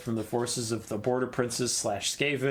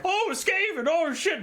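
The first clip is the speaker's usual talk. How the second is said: squeaky high panicked yelp; mock alarmed. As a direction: jump up into a startled, hammed-up shriek. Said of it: "Everyone else is like".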